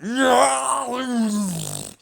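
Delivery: loud high bitter laughing cackle. Real, not acted. Mocking the facial-change demand, loud harsh laugh.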